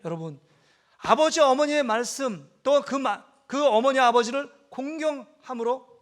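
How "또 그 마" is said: ordinary delivery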